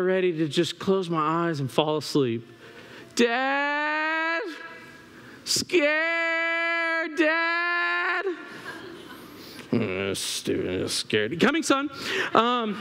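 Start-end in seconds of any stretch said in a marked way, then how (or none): 3.1-8.2 high, long-drawn call for Dad; drawn-out, high child's wail; scared child calling. Retold or imitated. Son calling out from bed. 9.7-11.4 very low, slow grumble; low, reluctant bedtime grumbling; sleepy grumble. Retold or imitated. Sleepy dad grumbles in bed. 11.4-11.9 higher, put-on willing call; pitched-up answer to a child; dutiful dad reply. Dad answers after grumbling.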